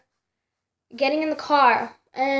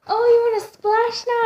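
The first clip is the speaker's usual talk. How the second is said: high mock voice of the boyfriend; high, playful taunt; playful challenge; 9 semitones higher than usual. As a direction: tease like he's daring her back. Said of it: "he's all like".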